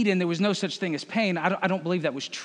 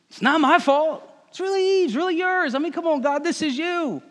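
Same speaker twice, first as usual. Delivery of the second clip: much higher whiny excuse voice; Adam's excuses; 9 semitones higher than usual. Retold or imitated. Adam passing blame to Eve and God, high whiny squeal. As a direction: squeal out excuses like a caught child. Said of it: "Adam has done".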